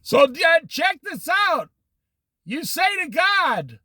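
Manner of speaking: preaching shout. Real, not acted.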